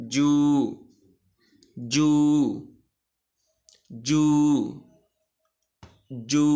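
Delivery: "যা", "জি", "জু" each with the vowel drawn out one beat; one-beat stretch. Shown as a demonstration. Teacher leaving gaps for learners, stretched "যা", "জি", "জু". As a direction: hold "যা", "জি", "জু" singly on a level note. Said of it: "এক টান হবে শুধু".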